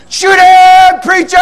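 high, loud drawn-out holler; retold full-voice shout; shouted plea. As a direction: yell it across the woods, stretching the words. Herman yells during bear hunt.

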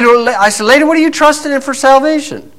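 high-pitched, pressing question; insistent challenge. Retold or imitated. Retold challenge to the woman.

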